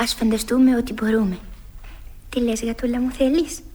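usual speaking voice

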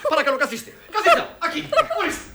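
high, agitated protest; exasperated scolding. Acted. Director orders a laughing actress to sit down, exasperated.

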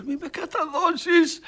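high, loud, slow desperate plea; shouting. Retold or imitated. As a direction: yell it like a man terrified of betrayal. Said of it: "Μου φώναζε". (απελπισμένη κραυγή)

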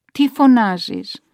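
loud, drawn-out irritated demand; exasperated scolding. A narrator's reading. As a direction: scold an old mother for calling out. Relative scolds the calling mother. (εκνευρισμένη, δυνατή επίπληξη)

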